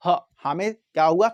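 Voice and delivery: ordinary delivery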